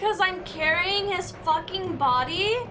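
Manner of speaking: loud exasperated outburst at the game; frustrated yell. Real, not acted.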